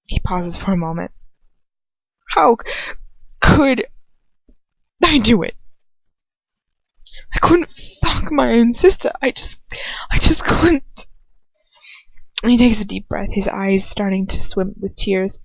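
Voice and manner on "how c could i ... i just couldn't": high, voice cracking into a cry; anguished crying brother, much higher; breaking down. Acted. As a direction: fight the tears and lose. Ron breaks down.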